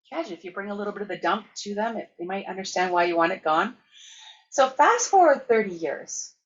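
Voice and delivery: ordinary talk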